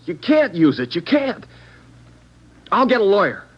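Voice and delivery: high, loud, desperate protest; panicking, begging them not to; frantic pleading. Acted. Suspect begs detectives not to print his photo, frantic.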